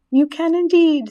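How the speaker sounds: high, loud little chorus voice; spiderlings replying. A narrator's reading. Spiders assure Wilbur they are staying, high eager reply. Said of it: "said the spiders".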